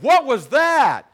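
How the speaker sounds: loud, high, drawn-out retold outcry; astonished shout. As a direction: act out a stunned yell, stretching the words. Retelling his astonished outcry to friend.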